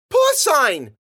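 put-on very high, loud child's outburst; blurting little girl. A narrator's reading. Third sister butting in with her word, loud and high.

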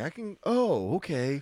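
surprised waiter. Retold or imitated. Waiter surprised by their origin.

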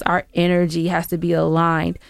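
usual speaking voice

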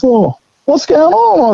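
high, slow drawn-out curious voice; young bloke. Retold or imitated. Young bloke arriving, asking slowly, high.

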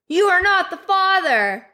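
high, slow dramatic reveal; Maury host announcement. Retold or imitated. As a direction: announce it like a talk show host. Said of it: "Maury is, like".

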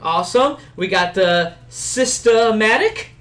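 loud, high, drawn-out host roll call; listener shout-outs. Acted. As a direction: hype up each name like a show host.

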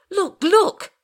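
very high, loud urging; frantic shout. A narrator's reading. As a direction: yell it, desperate to be believed. Panicked passenger points at window.

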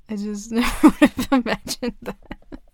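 loud giggling through the words; nervous laughing. Acted. Friend laughs after their first kiss, nervous laughing. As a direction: laugh it off, flustered. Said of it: "I'm nervous laughing".

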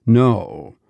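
ordinary delivery, nothing marked

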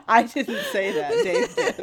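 squealed through laughter; laughing high. Real, not acted. Host laughingly blames koala in a high squeal.

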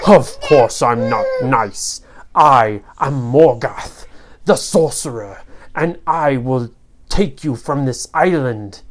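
low, slow, emphatic villain; menacing storybook sorcerer; evil sorcerer, threatening. Acted. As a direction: declare your wicked name with relish.

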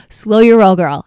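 mock warning. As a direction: rein in the teenager with playful sass. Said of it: "And I'm like".

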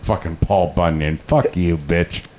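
ordinary talk

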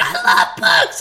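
breathless high squeal with a laugh; imitating a giddy clerk; mock squeal. Hyped Apple rep retold.

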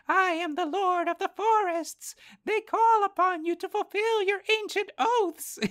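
a tiny forest creature declaring grandly; high squeaky pet-creature voice proclaiming an oath; Goblin's voice. Retold or imitated. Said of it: "And I'm using Goblin's voice".

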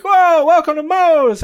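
high, loud, slow welcome call; hyped greeting. Retold or imitated. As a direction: staff shouting hello at the door. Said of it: "they're all like".

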